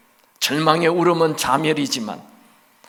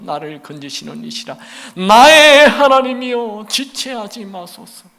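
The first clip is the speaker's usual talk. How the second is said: impassioned psalm recitation; 3 semitones higher, 6 dB louder than usual. Acted. Preacher reading psalms to congregation as anguished prayer. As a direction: cry each 하나님이여 then fall back.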